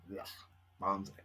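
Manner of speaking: ordinary talk, nothing marked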